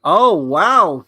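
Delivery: loud, delighted exclamation; impressed. Real, not acted. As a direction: burst out, genuinely wowed. Reacting to the broadcast.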